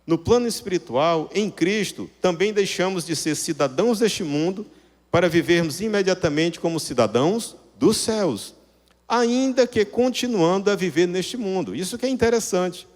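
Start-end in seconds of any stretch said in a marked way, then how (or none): none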